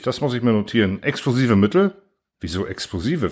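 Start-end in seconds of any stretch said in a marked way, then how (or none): 2.4-3.3 mildly puzzled, lower-pitched question; confused. A narrator's reading. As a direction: softly query it, a touch bewildered. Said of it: "Fragte ich verwirrt". (leicht verwirrte, leise Rückfrage)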